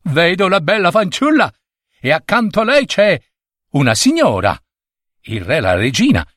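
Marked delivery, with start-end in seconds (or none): 0.0-4.6 put-on high, eager child-prince voice; thrilled, high-pitched announcement; excited little prince. A narrator's reading. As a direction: tell it high, full of wonder. Excited little prince describes his vision.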